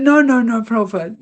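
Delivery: high, loud flustered protest; a loud, high-pitched and frantic no; panicked denial. Retold or imitated. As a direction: blurt it out in a rush of panic. Panicked denial to her guru.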